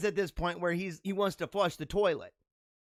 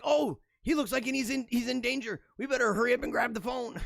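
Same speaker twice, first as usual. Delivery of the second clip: higher, rushed mock alarm; mock panic; 6 semitones higher than usual. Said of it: "It's like".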